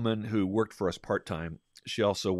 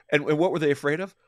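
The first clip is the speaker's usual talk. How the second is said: rhetorical question; 7 semitones higher, 7 dB louder, faster than usual. Real, not acted. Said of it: "rhetorical question".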